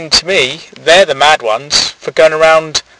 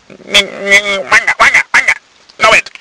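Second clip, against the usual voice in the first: gibber like a lunatic, pitched way up; mock madman; 7 semitones higher than usual. Mock mad person babbling.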